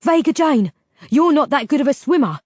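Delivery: loud, worried objection; worried friend. Acted. Delph voiced, worried.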